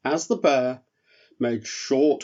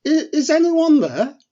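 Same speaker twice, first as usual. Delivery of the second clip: high calling elf voice, louder; Eric calling out; 13 semitones higher, 6 dB louder than usual. Acted. Eric the elf calls into the dark cave, high and loud. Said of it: "called out Eric".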